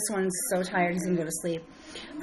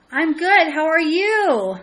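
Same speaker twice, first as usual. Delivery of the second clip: cheerful greeting; 6 semitones higher, 7 dB louder, slower than usual. Real, not acted. Greeting a live viewer.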